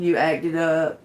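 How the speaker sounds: ordinary talk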